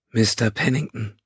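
rough, hoarse Vulcan woman. A narrator's reading. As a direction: speak with a raw, hoarse throat. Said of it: "mit rauer Stimme".

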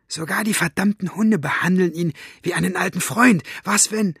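high-pitched, agitated aside; indignant hissing. A narrator's reading. Elinor suspecting the men are friends, hissing. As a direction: hiss your suspicion, pitched high. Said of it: "zischte Elinor".

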